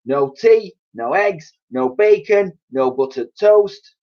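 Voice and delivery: put-on high, loud ranting old woman; shouting grandma. A narrator's reading. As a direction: bellow complaints at the family. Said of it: "and she shouted".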